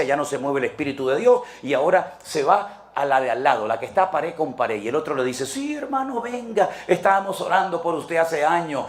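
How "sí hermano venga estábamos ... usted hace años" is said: high-pitched gushing greeting; sing-song high acted welcome; effusive mock welcome. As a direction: greet him like a long-awaited brother, hammed up. Rival church welcomes the deserter.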